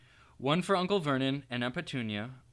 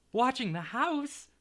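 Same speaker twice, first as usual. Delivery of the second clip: high, slow trembling question; quivering, frightened; 11 semitones higher, slower than usual. Acted. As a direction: fearfully ask if they're watched. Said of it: "in a quivering voice".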